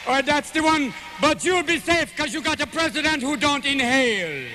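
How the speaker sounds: slow, laid-back Jamaican storytelling; Jamaican accent. Acted. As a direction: stretch the words out in a Jamaican lilt.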